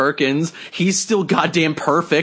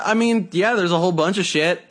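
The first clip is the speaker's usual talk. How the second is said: ordinary talk, nothing marked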